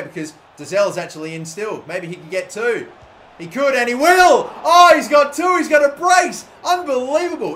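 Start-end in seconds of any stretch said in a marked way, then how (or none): 3.4-7.5 loud, high joyous yells; celebrating a brace; excited shouting. Real, not acted. Celebrating a second goal.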